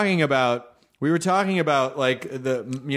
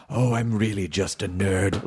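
low, purring sultry mimicry; 7 semitones lower than usual. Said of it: "in that sultry voice".

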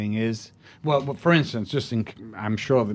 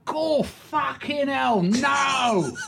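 high, drawn-out Cockney exclamation; hammed-up Cockney astonishment, pitched way up; 13 semitones higher, much slower than usual. Actor retelling a pub encounter, hammed-up Cockney. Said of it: "this Cockney comes in".

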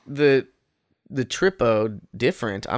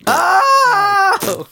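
loud high shriek of surprise; startled cry; shocked yelp; 9 dB louder than usual. Real, not acted. Shocked reaction to fart joke.

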